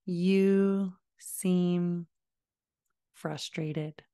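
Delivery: slow, soothing, trailing soft; gently name the feeling; soft calm validation. Shown as a demonstration. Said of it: "try something like".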